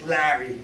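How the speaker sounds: "Larry" pulled wide as LAA-ree with a grimace; mock disgusted "Larry"; Larry with a face. Shown as a demonstration. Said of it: "you make a face".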